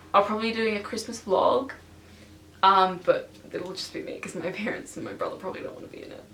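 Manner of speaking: ordinary talk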